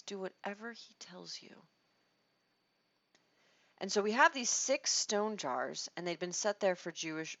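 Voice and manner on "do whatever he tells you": whispered humble obedience. Shown as a demonstration. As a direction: breathe it as a quiet surrender. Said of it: "picture of humble obedience".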